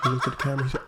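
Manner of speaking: ordinary talk, nothing marked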